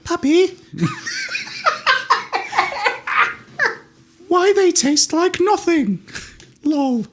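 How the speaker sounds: put-on high squeaky kid voice, drawn out; mock child voice, high and slow; child's voice. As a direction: play a whiny little kid asking. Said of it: "the child looked at the parent and went".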